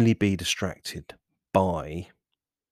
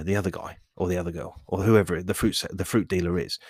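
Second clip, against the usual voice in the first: ordinary delivery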